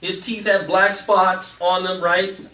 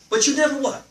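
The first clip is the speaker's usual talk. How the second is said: ordinary delivery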